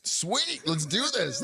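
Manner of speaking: high eager "sweet"; pumped up, ready to go; excited. Retold or imitated. His eager reply.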